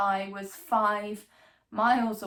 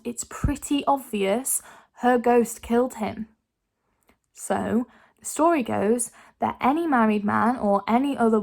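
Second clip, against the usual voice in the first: loud, theatrical ghost-story telling; hammy stage actor; 7 dB louder than usual. Acted. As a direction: ham up the spooky tale for the crowd.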